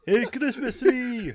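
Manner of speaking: high, slow and drawn out; movie quote voice. Retold or imitated. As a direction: stretch the line out, up high. Echoing the movie line.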